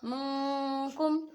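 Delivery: nasalised मिनकुम recitation; ikhfa with nasal hold. Shown as a demonstration. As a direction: draw out मिनकुम with a hidden nasal नून. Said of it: "इस तरीके से पढ़ना है".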